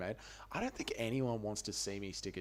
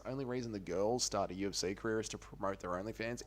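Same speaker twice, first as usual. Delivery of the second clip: normal talking voice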